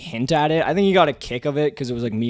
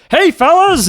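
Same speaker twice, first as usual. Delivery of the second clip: cheery call; 10 dB louder than usual. Retold or imitated. Retold greeting to coworkers.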